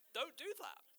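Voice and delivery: ordinary talk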